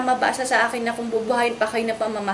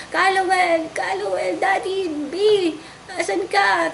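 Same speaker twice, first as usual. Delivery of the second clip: retold calling out; 6 semitones higher, slower than usual. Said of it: "sisisigaw po ako".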